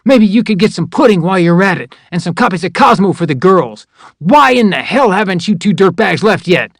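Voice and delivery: high, loud, sneering bark at a recruit; mock the soldier hard, then demand answers; livid drill sergeant. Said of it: "he was livid".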